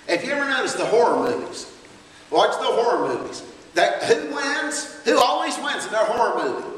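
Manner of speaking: loud, driving preaching to the church; full-force preaching. Acted. Preacher exhorting the church, loud and driving. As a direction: preach it hard to the congregation.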